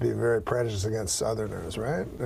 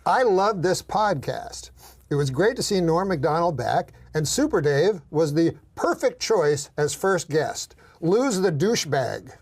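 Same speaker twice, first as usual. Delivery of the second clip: reading a comment; 4 semitones higher than usual. Retold or imitated. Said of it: "He says".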